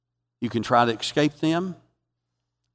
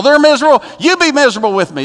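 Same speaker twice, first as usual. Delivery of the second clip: loud, high, demanding; acted misery-spreading; 9 semitones higher, 7 dB louder, faster than usual. Preacher mimicking miserable people, high and insistent. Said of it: "they want everybody to be miserable".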